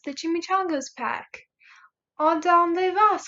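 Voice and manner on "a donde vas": higher, louder, drawn-out call; storybook hero calling out. A narrator's reading. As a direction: call it out slowly to the group. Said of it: "called out Skipito".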